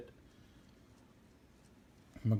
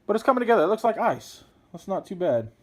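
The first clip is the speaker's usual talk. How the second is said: pleased; 9 semitones higher, 5 dB louder than usual. Real, not acted. Pleased with icy paint effect.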